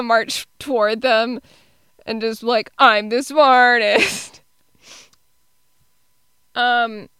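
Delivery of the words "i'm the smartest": slow, louder mock boast; Luigi. Imagined retort to anybody who questions her, mock boasting. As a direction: declare it slowly and emphatically. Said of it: "I just wanna say it anytime".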